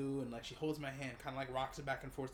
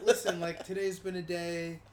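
exasperated plea; 4 semitones higher, 10 dB louder than usual. Retold or imitated. Retold exasperated plea to doctor.

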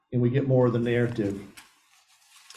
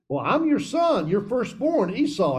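Esau's reply; 9 semitones higher than usual. Acted. Esau answers his blind father.

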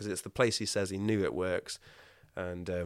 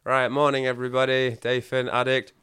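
loud, higher group welcome; group leader call; 4 semitones higher, 9 dB louder than usual. Retold or imitated. Leading morning affirmations for the rehab group, loud and bright. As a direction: call the room to order cheerily. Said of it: "So, like".

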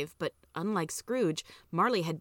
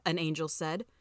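ordinary delivery, nothing marked

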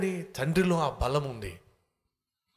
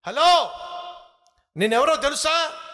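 high, loud, cocky challenge; high-pitched bragging yell; boastful fox shout; 10 semitones higher, 5 dB louder than usual. Acted. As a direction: call out self-importantly as the fox. Fox brags to the lion.

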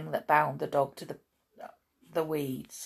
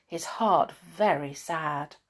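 ordinary delivery, nothing marked

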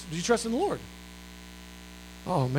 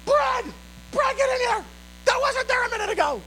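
mock scream much higher; hint of a shrieking wife, much higher. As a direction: shriek for your husband from the other room. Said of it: "I hear Amy screaming".

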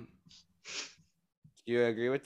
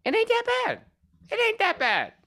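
squeaky joke voice; faster than usual. Pretend. Repeats phrase in high voice.

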